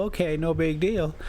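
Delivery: ordinary talk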